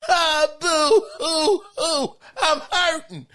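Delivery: high mock boo-hoo through a laugh; mock crying. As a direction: pretend to sob, laughing. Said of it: "that's what Cody is saying".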